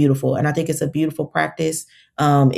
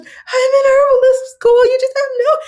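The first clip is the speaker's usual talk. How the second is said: re-living her sobbing outburst, much higher and louder; bawling; 5 dB louder than usual. Retold or imitated. Overwhelmed that the godfather saw she studies herbs, a high tearful wail. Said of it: "And I start bawling".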